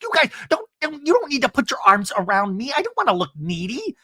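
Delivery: high, fussy protest; hammed-up high protest; mock protest. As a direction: whine that you don't need help. Imitating Shannon protesting.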